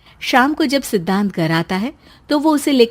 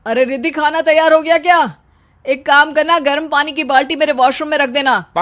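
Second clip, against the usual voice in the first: loud demanding husband, higher; bossy husband calling; 4 semitones higher, 4 dB louder than usual. Acted. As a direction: call out orders to the wife. Husband orders pregnant wife. (हुक्म देता पति)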